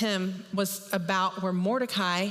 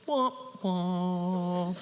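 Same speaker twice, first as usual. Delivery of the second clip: sad-trombone imitation; mock disappointment in two drawn-out wahs; dejected "wah, wah". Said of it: "he's so sad".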